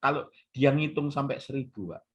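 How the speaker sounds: ordinary talk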